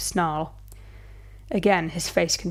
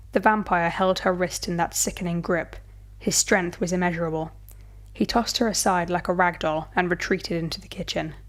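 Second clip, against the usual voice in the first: ordinary delivery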